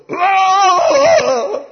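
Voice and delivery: loud, very high imitated wail; eerie voice's scream. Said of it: "this voice goes".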